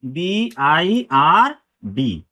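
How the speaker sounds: very slow, stretched letters बी, आई, आर, डी; letter-by-letter teaching pace for बी आई आर डी; spelled out slowly. Shown as a demonstration. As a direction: draw each letter out with a gap after it.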